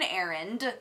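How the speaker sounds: "errand" articulated with care; "errand" carefully distinct from Aaron; careful pronunciation. Real, not acted. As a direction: pronounce "errand" precisely, its final d audible. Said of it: "perfectly pronounced so that you would know the difference".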